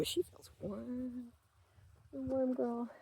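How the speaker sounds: soft and slow, to the animal; tender murmur. Real, not acted. Petting her animal on camera, soft tender murmur. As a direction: murmur fondly to her pet.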